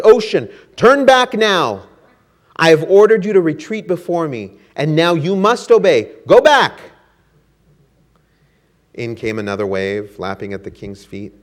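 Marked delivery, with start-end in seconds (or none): 0.0-6.8 loud, higher royal shout that peaks at the end; shouted command. Acted. Canute shouting at the ocean to go back, loud and furious. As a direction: bellow at the waves to retreat. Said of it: "Canute shouted".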